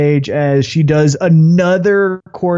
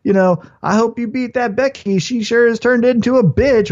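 playful Naomi voice; 6 semitones higher than usual. Retold or imitated. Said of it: "Naomi's like".

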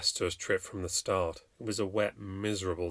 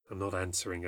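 low, soft talk to oneself; quietly resolve under the knocking, lower voice; 4 semitones lower, 8 dB quieter than usual. A narrator's reading. Mother telling herself to ignore the door, quietly to herself. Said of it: "I said to myself".

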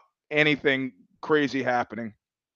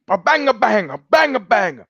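mock Italian accent, higher pitched; Italian soldier; 6 semitones higher than usual. Soldier fakes rifle shots in mock Italian accent. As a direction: fire the fake rifle. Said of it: "He goes".

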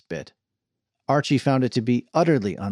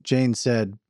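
usual speaking voice

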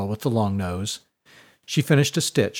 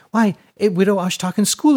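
put-on high chatty girl; 8 semitones higher, 5 dB louder than usual. A narrator's reading. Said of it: "high, breathy voice".

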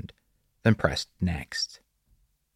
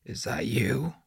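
higher, half-whispered question; sputtered; 5 semitones higher, 4 dB quieter than usual. A narrator's reading. Doug questions the unseen boy, sputtering softly. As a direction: ask it in a raised, unsteady half-whisper. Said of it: "Doug sputtered".

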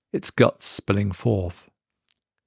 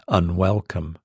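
ordinary delivery

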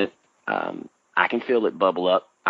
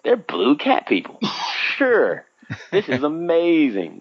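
higher, hammed-up sarcastic praise; mock sarcasm; 6 semitones higher, slower than usual. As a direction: pretend to be impressed while rolling your eyes. Mocking Hollywood's blue cat people.

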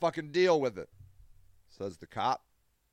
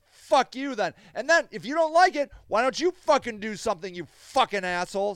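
higher, louder furious outburst; 6 semitones higher, 5 dB louder than usual. Real, not acted. Advocate yells at people calling him an enabler, furious. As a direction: yell it at your critics. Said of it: "See, I, I get so mad".

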